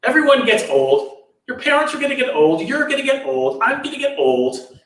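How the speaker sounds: high, insistent retold list; emphatic insistence. Channa tells the sheltered prince about ageing, emphatically matter-of-fact. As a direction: rattle off each person as if it's obvious.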